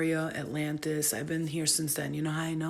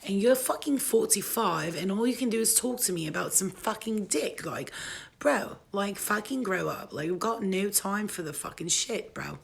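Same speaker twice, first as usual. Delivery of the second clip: put-on ranting in a playful British accent. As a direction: complain like a fed-up Brit, British accent. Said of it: "I've been on my, my British English accent for a minute".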